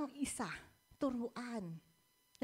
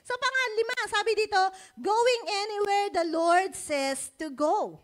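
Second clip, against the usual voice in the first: preaching; 8 semitones higher, 10 dB louder than usual. Real, not acted. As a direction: declare it from the pulpit. Preacher proclaiming the point.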